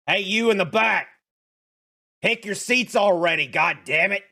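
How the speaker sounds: loud, high shout to the back row; yelling teacher. Acted. Teacher shouting at a student in the back, loud. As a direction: bark at the kid in the back. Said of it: "He yells at all of you and says".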